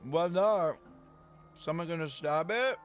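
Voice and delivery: normal talking voice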